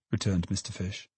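ordinary delivery

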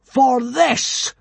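high, loud pronouncement; grand, weighty proclamation; solemn boom. A narrator's reading. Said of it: "boomed Hiccup solemnly".

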